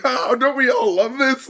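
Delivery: high, drawn-out sweet enthusiasm, imitating the dog; cheery while cursing. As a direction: gush it slowly, mock-delighted. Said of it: "he's, like, cursing them out, but kinda going like".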